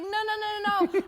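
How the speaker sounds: loud, high string of refusals; frantic protest. Retold or imitated. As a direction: reenact a panicked refusal. Retelling protest at nose strip.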